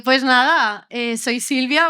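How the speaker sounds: usual speaking voice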